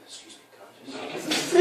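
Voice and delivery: high, slow, hushed mock-creepy voice; hammed-up creepy murmur; quiet and creepy. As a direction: creep in close with a whispery plea, half laughing. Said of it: "be all quiet".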